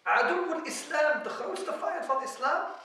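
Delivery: high, loud cry of accusation; retold shout. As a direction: call the enemy out loudly, imitating a shout. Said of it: "Hij schreeuwt van".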